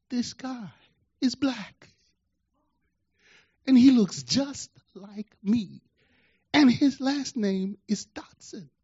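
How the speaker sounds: high, slow, weighted delivery; dramatic reveal. Retold or imitated. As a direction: imitate a son dropping a bombshell. Son drops the bomb on father.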